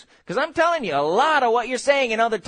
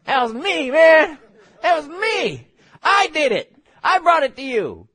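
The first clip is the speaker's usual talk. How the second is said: loud, indignant angel claiming credit; hammed-up exasperated angel wanting recognition; mock angel protest; 6 semitones higher, 5 dB louder than usual. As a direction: protest in short, punchy bursts, pitched up.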